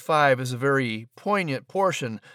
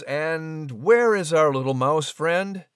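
normal talking voice

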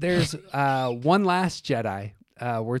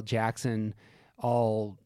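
normal talking voice

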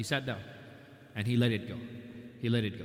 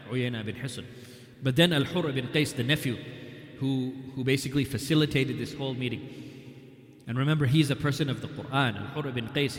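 usual speaking voice

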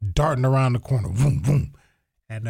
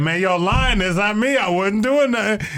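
pleading through tears; 7 semitones higher than usual. Retold or imitated. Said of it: "But I was like".

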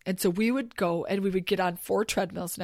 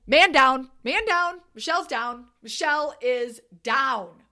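alarm call; 4 semitones higher, 5 dB louder, slower than usual. Retold or imitated. Alarm when friend falls.

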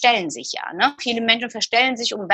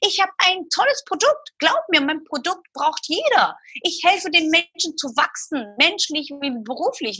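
urging enthusiasm; 6 semitones higher than usual. Retold or imitated. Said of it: "Und dann sage ich".

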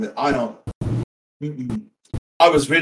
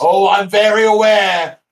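slow, loud, sarcastic; drawn-out sarcastic yell; exasperated shout; 4 semitones higher, 7 dB louder, slower than usual. Acted. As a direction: bellow it in exasperation. Exasperated about the cricket.

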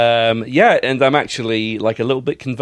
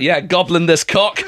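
mock shout; 8 semitones higher, 4 dB louder, slower than usual. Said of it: "I would have just shouted out".

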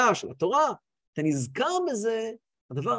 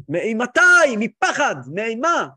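loud, raised cry of dread; trembling awe; 2 semitones higher, 9 dB louder, much slower than usual. Acted. Reb Zusha explains the word as dread, loud awed cry. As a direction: cry each word out in holy fear. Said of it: "הוא התחיל להזדעזע".

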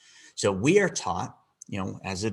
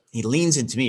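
ordinary talk, nothing marked

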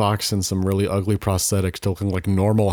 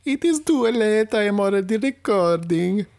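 put-on [name]'s Italian accent; 12 semitones higher than usual. Italian persona.

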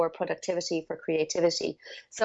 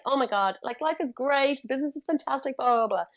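higher, bubbly imitation of a cheerful partner; happy and jolly; 6 semitones higher than usual. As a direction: mimic a man who thinks life is great. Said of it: "He was so happy and jolly".

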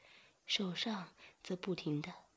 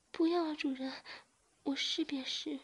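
quick frightened protest; 9 semitones higher than usual. A narrator's reading. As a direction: blurt it out in alarm. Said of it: "马上说".

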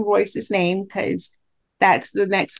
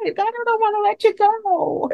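high, drawn-out mock whine; pretend-pouting, stretched-out plea; playful reluctance; 11 semitones higher than usual. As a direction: whine it slowly, as if refusing a goodbye. Playful reluctance to end the interview.

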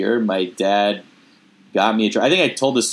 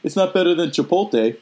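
higher, dismissive dad voice; 7 semitones higher than usual. Retold or imitated. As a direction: pitch up and dismiss it like his dad. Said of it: "When you do your dad voice".

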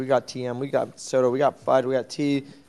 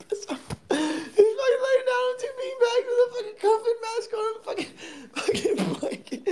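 laughing. Real, not acted. Laughing at sleeping friend.

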